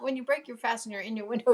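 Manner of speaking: usual speaking voice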